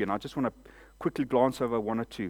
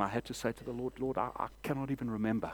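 much softer and quicker, a shamed admission; ashamed confession; 3 semitones lower, 9 dB quieter, faster than usual. Retold or imitated. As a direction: confess it quietly to the Lord. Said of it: "with shame".

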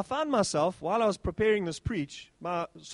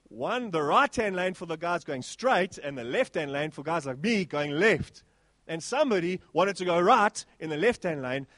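usual speaking voice

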